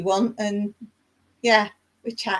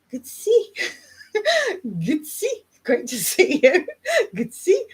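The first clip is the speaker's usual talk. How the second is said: giggling through the words, high rising ends; laughing at your own attempt; 9 semitones higher than usual. Real, not acted. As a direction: read it out, breaking into real giggles. Amused at misreading the chat.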